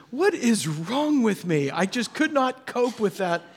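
high, drawn-out lament; hammed-up bewildered dismay; exasperated self-questioning. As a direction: wail mildly at your own failure. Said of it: "I sat there in the boat thinking".

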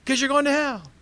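loud, high-pitched alarmist cry; mock zealot preaching. Zealot answers why you need Jesus, mock alarmist shout. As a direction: blurt it high like a clueless street preacher.

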